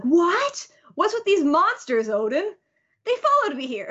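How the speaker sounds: high, incredulous retold question; mock astonishment. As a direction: react baffled, pitch jumping up. Acting a startled reaction to monsters.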